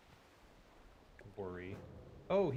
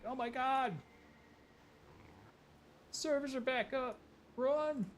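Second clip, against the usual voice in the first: mock panicked shout; slower than usual. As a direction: shout it like a stampeding crowd. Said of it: "people here will be shouting, like".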